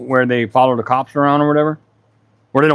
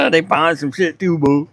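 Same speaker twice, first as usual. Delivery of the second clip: ordinary delivery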